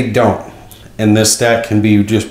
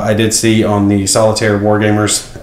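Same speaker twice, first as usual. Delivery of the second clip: ordinary talk, nothing marked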